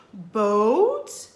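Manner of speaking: light up at the word boat; excited. A narrator's reading. Ghost Queen recognizing the boat, excited. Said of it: "She got excited".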